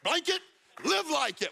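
high, punchy retold exclamation; imitating a man telling his friend to live it; blunt advice. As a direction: throw it out like a friend's sharp wake-up call. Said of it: "He was like".

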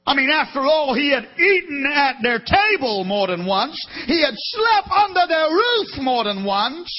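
loud, rising preacher's cry; fervent pulpit build; impassioned preaching. Acted. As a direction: press the point harder each line. Preaching at full force.

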